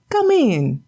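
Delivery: high, nagging wife's voice; scolding wife. Acted. Wife scolding her husband for an empty catch.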